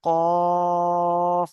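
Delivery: very slow recitation of letter names; drawn-out letter recitation. Shown as a demonstration. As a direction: stretch each letter name long and sung. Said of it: "দেখেছেন?".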